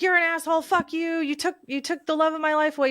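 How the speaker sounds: high-pitched imitation of an enraged dad; retold angry father. Daughter imitating her grieving father cursing the driver on the phone, angrily. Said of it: "he was grieving and he was angry".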